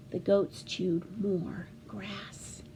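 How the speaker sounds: soft slow whisper. A narrator's reading. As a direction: hush the line down, slowly. Unvoiced whispered narration.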